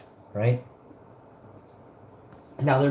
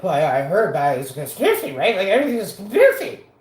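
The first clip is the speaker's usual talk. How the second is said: dismissive; 4 semitones higher, 6 dB louder, much faster than usual. Retold or imitated. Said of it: "they're like".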